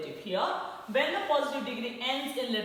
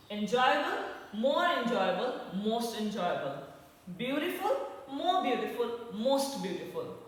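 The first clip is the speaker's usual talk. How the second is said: ordinary delivery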